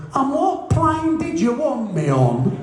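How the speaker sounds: high, slow squeaky voice; slow and high, a big man with a tiny voice; Joe Bugner's squeaky voice. Retold or imitated. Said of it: "he had a very high-pitched voice".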